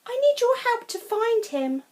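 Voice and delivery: high, loud urgent plea; rally the kids to help; urgent farmer. Acted. Farmer urging Poppy and Sam to help find Curly, high and urgent.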